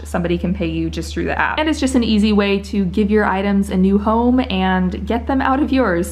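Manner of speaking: usual speaking voice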